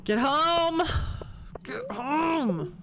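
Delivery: urgent, frantic yelling; panicked shout. Real, not acted. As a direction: yell it in a rush to escape. Racing home in panic.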